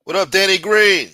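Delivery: loud, high shout-out; loud greeting. Real, not acted. Streamer hailing a viewer in chat, loud and high. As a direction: call out the name loudly.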